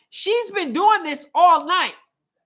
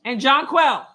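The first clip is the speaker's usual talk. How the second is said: ordinary talk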